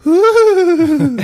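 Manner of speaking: high, loud giggly coo; mock coo. Girl reacting to the trinket, a high giggly coo.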